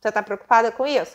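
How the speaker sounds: normal talking voice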